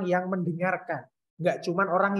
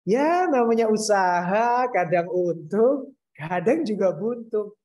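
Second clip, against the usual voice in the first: mismatched cheer, drawn out and louder; beaming, higher, sing-out happy delivery; happy, smiling; 3 semitones higher, 4 dB louder, slower than usual. Shown as a demonstration. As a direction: read a sad line with a big audible smile. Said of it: "ekspresi bahagia gitu".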